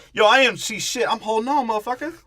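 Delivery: squeaky panicked impression of the guy. Friend imitating [name] hanging on for dear life, high and loud. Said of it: "that's what [name] says".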